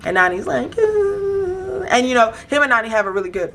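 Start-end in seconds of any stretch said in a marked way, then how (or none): 0.8-1.8 long, very high imitated squeal; mock squeal. Castmate reacting to being picked as partner, mock squeal. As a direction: draw out a high held coo.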